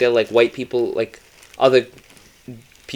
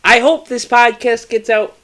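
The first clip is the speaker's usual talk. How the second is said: dramatic announcement; 9 semitones higher, 4 dB louder than usual. Acted. Mock announcer doom.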